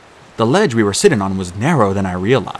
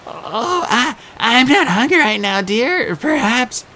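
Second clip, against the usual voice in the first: much higher, fluttery, stammering; a scared groom-to-be faking sweetness; nervous satyr bride; 13 semitones higher, slower than usual. A narrator's reading. Grover playing the bride.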